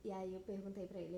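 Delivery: ordinary talk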